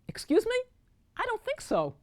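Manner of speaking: high incredulous excuse me; shocked high protest; indignant disbelief. Retold or imitated. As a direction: squeak it out in outraged disbelief. Indignant refusal to nurses.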